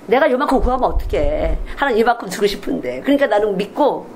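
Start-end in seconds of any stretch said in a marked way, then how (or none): none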